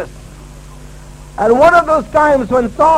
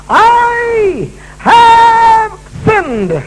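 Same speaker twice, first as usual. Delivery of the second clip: anguished confession; 12 semitones higher, 5 dB louder, much slower than usual. Acted. Saul's confession dramatized.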